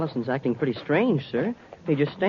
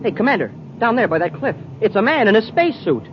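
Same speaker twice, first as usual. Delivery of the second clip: higher, louder excited spotting cry; excited call; 7 semitones higher, 3 dB louder than usual. Acted. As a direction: point it out urgently, pitched up. Spots a survivor below.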